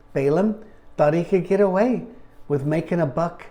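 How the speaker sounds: ordinary delivery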